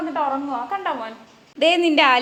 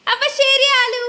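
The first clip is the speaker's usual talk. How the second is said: sing-song goodbye; 11 semitones higher, 4 dB louder, slower than usual. Real, not acted. Mother saying bye to sleepy Aalu, high sing-song. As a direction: call a playful goodbye in a high voice.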